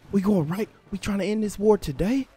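high, loud incredulous questions; shocked disbelief. Real, not acted. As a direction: react in stunned disbelief. Surprised by bold army move.

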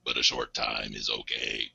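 deep, strained 1980s robot voice; old speech synthesizer. Shown as a demonstration. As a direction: push the voice low like an old robot. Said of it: "stress my vocal cords to the limit".

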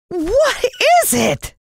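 high, slow, terrified scream. A narrator's reading. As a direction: shriek at the sight of the zombie. Jalan terrified by zombie.

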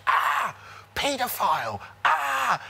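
high, loud mock shriek; sudden high screech of accusation; held-back scream. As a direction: imitate a screamed accusation, reined in for the mic. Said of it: "she screams, and I won't do it 'cause of the microphone".

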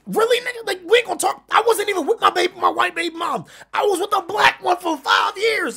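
high, loud, worked-up protest; exasperated, loud high-pitched objection; incredulous protest. Acted. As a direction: voice jumps way up in disbelief. Heated protest at false assumption.

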